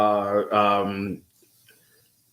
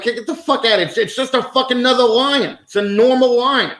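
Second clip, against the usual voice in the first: rising rant; 9 semitones higher than usual. Real, not acted. Ranting about gene hype.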